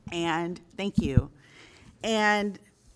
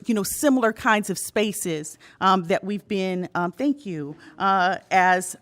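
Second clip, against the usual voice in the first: ordinary talk, nothing marked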